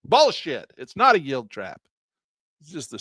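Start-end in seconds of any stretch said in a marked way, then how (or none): none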